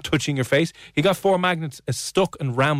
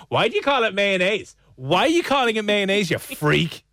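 high, indignant mock challenge; mock picking-on; 7 semitones higher than usual. Host mimics co-host criticising dates' words, mock indignation. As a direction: nag in a high, put-on outrage. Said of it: "picking people up going".

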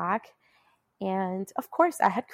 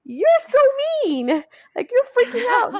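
high, loud, fast, breaking with emotion; exasperated outburst; 9 dB louder, faster than usual. Retold or imitated. To the stern tech, a high teary outburst. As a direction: blurt it out half-crying, way up in pitch. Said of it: "And I'm like".

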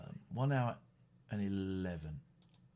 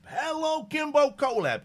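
announcer voice; 11 semitones higher, 6 dB louder than usual. Acted. Announcing a chat member loudly.

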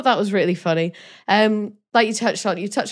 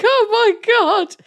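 shocked; 14 semitones higher, 5 dB louder than usual. Retold or imitated. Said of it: "I was like".

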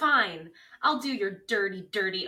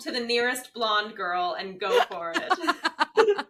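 laughing; 4 semitones higher, 6 dB louder, slower than usual. Real, not acted. Laughing through her own joke.

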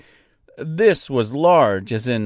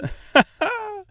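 put-on high Austrian-French accent laugh; hearty high laugh in the Austrian-French accent; bird laugh. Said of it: "It laughed".

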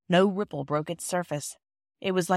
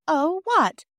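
high, loud, eager question; bursting to know; 10 semitones higher, 6 dB louder than usual. A narrator's reading. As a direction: pounce on it like a curious child. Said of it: "asked Phyllis eagerly".

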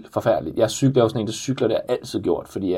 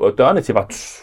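ordinary talk

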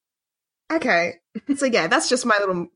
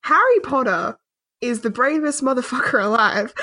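usual speaking voice

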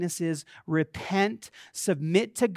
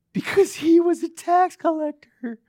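high, put-on answer to the preacher; put-on congregant voice; 11 semitones higher, 7 dB louder than usual. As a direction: pipe up as a helpful church sister.

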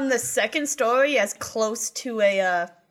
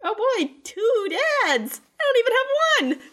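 high whiny character voice; 13 semitones higher, 3 dB louder than usual. Acted. Character's sad joke.

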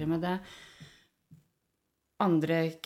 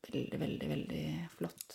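hushed awe; 4 semitones lower, 11 dB quieter than usual. Real, not acted. Awed about the body's potential.

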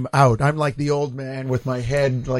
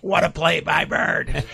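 announcer impression; slower than usual. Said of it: "favorite lines".

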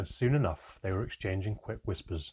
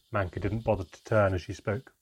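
ordinary delivery

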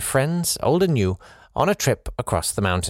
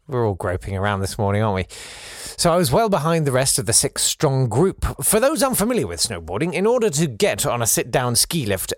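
ordinary talk, nothing marked

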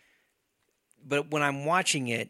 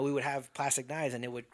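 ordinary delivery

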